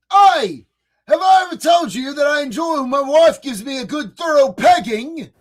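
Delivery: mock British politician, opening on a loud "Oi"; British accent. Streamer mocking the British leader, exaggerated British accent. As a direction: put on a hammed-up British accent. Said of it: "with the British accent".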